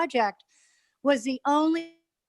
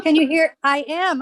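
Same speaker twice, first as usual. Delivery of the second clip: loud into phone; 2 semitones higher, 7 dB louder than usual. Real, not acted. Talking right into the phone.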